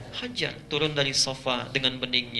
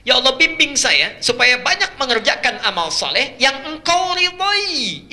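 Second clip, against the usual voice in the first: much higher, louder, drawn-out plea; fervent supplication; 9 semitones higher, 6 dB louder, slower than usual. Acted. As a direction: cry out to God, stretching the last word.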